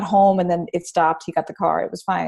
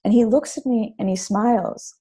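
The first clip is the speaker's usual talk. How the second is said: ordinary delivery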